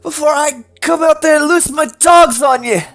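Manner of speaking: put-on high, loud furious old dwarf yelling; furious shouting. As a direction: scream them off the property. Tolgi threatens visitors.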